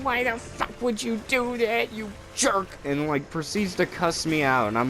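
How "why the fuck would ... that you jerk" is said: high, whiny imitation of a tearful boy; mock crying kid. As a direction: wail it like a crying child, hammed up. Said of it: "But he's also crying".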